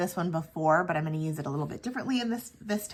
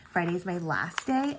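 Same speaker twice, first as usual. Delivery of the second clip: ordinary talk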